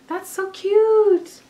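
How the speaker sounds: high, slow, stretched coo; cooing gush. Real, not acted. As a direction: coo over it tenderly. Admiring the crop top.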